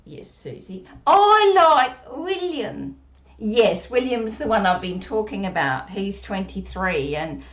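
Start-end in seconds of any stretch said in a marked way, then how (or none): 1.1-3.0 put-on puppet child voice. Puppet Susie speaking.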